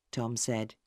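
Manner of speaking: usual speaking voice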